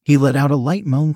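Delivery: ordinary delivery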